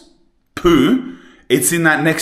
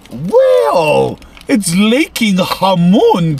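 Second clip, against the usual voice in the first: excited; 5 semitones higher, 3 dB louder, much slower than usual. Real, not acted. Reacting to food.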